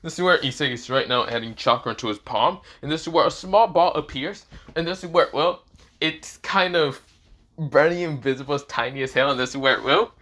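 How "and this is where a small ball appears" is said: plain reading voice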